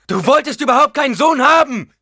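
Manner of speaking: furious high-pitched yell; full-force bitter shout; screaming. A narrator's reading. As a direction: scream the accusation at your mother. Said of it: "schrie er".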